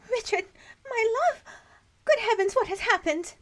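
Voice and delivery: high, theatrical cry of horror over his body; anguished wife. Acted. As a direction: gasp it out like a Victorian widow. Wife finds husband dead, acted.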